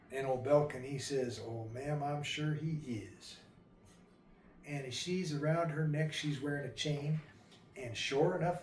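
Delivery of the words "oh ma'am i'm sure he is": hushed, kindly comfort; gentle reassurance. Acted. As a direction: reassure quietly, easing slower at the end. Suitor softly reassures grieving bride.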